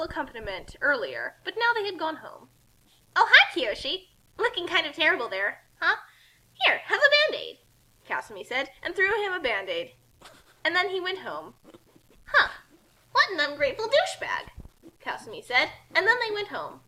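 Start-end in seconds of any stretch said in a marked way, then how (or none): 3.1-7.6 high, bright, cheerful greeting; perky Kasumi. Acted. Kasumi teasing injured Kiyoshi with a Band-Aid. 12.2-14.5 indignant. Acted. Kasumi's indignant remark.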